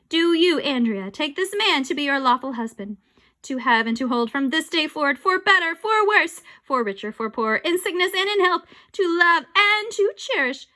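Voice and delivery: loud, grand vow reading; booming officiant. Acted. Mayor reading the vows, loud. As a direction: proclaim like a mayor.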